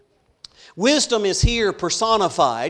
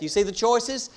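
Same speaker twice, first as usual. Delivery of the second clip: ordinary talk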